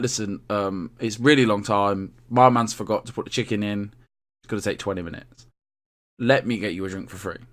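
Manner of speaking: low, soft, confidential apology; soothing customer service. Retold or imitated. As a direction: let the customer down gently and quietly. Playing good-with-customers server.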